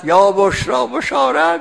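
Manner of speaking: preacher acting out a happy cry at the well; joyful shout. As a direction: call out the good news like the caravan man. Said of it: "خیلی داد زد".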